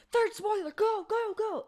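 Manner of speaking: high, loud hammed-up cheer; a mock fanfare for a spoiler; spoiler joke cheer. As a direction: yell it like a gleeful game-show host. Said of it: "that was the last time I'll make that joke".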